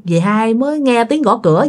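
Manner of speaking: ordinary delivery, nothing marked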